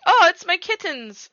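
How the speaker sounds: high, loud, slow mock French lady that slides into Italian; a bad French impression that comes out Italian; failed French accent. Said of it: "was trying to be French and it didn't work".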